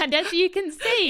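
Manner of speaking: laughing through the words; giggling, high and loud. Real, not acted. Laughing at the accent.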